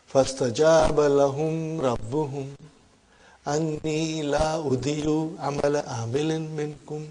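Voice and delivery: rapid, murmured recitation of the verse; fast, soft Quranic recitation. Shown as a demonstration. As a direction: run through the verse quickly and softly.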